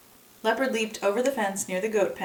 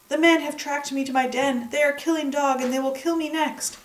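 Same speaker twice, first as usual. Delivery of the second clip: frightened; 7 semitones higher, 3 dB louder than usual. Acted. Leopard panics in his den.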